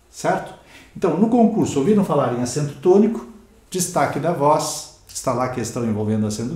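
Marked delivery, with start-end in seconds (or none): none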